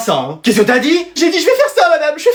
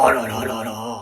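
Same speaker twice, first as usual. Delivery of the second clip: dismayed groan; 11 semitones lower, 9 dB quieter than usual. Acted. Disgust at recipe.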